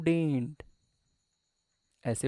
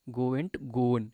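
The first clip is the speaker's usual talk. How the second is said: slow recitation; much slower than usual. Shown as a demonstration. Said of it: "है ना?".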